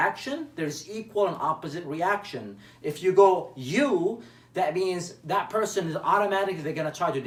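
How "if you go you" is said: the second you punched louder; stress on you. Shown as a demonstration. Coach stresses the blaming you, louder. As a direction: hit you like a finger point. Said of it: "When you say you".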